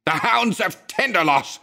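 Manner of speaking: high, terrified shriek; a cry of pure dread. A narrator's reading. Madman shrieks the name to his friend, high and terrified. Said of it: "he shrieked".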